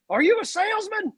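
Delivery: high hollered challenge across the yard; mock yell. Ed at his ranch yelling at the visitor, mock holler. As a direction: holler it at a stranger pulling in. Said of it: "and he yells".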